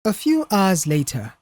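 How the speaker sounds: usual speaking voice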